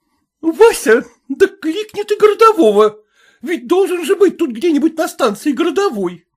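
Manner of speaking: very high female-character voice; high-voiced wife calling for help; alarmed wife. A narrator's reading. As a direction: urge your husband in a high, fretful pitch.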